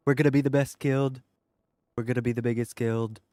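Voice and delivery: a self-admittedly weak impression of a boastful president; Donald Trump impression. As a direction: ham up a boastful politician promising big. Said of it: "I can't do Donald Trump very well".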